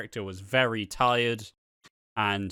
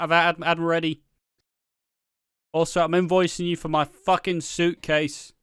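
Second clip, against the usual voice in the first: higher, louder breezy shrug-it-off voice; carefree breezy character; 5 semitones higher, 6 dB louder than usual. Retold or imitated. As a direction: cheerfully wave off disaster with laughs. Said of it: "the approach of just".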